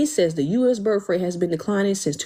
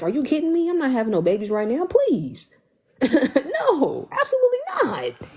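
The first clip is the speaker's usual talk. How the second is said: much higher, panicked laughing refusal; anxious panic; 7 semitones higher than usual. Real, not acted. Host refusing babies to chat in high anxious panic. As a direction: shriek the no like the idea scares you. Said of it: "The anxiety in my voice".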